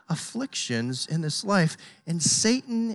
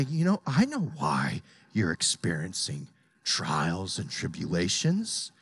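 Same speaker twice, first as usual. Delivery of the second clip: a hint of a low sly Satan; 11 semitones lower, 4 dB quieter than usual. Preacher voicing Satan's lie, a low sly hint. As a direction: lower the voice, lightly play the tempter. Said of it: "He's gonna say".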